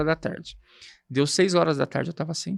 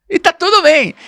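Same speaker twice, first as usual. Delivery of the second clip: louder, higher, laughing it off; laughing irony; 11 semitones higher, 9 dB louder than usual. Real, not acted. Telling the interviewer he memorised the wrong part, a loud, high laughing shrug. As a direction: throw the punchline out with a laugh. Punchline about his tiny line.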